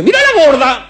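put-on high, loud child's cry; loud, high little boy pointing at a fat lady; child blurting out. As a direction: shout it out like a tactless kid.

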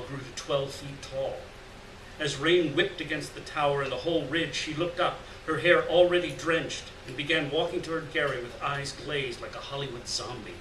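usual speaking voice

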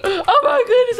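high, tearfully thrilled exclamation; about to cry. Acted. Fan about to meet [name], excitedly near tears. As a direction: overcome with excitement, pitch lifted. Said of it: "I'm about to cry".

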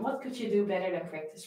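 ordinary talk, nothing marked

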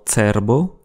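"cerbo" slowly, starting tser; "cerbo" said tser-bo, drawn out. Shown as a demonstration. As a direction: open "cerbo" with a crisp ts. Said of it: "respectivement ts".

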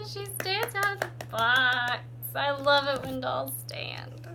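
loud, high, drawn-out happy exclamation; real delight. Real, not acted. Doll maker thrilled her doll stands out of the box, excited. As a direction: gasp it out high and loud, savoring each word.

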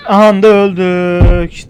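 slow, loud exasperated drawl; loud groan of defeat. Real, not acted. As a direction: stretch it out, fed up. Oyunda ölüyorlar. (yüksek sesle bıkkın)

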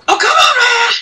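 high frustrated cry. Real, not acted. Frustrated in game.

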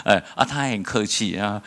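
usual speaking voice

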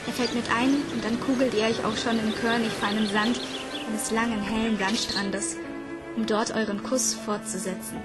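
usual speaking voice

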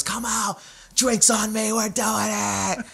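put-on high, slow, coaxing drunk; drunk party host. Drunk singer urging the comic back out to drink, high and slow. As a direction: drag him out like a party animal. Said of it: "He goes".

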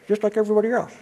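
ordinary delivery

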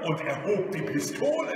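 ordinary delivery, nothing marked

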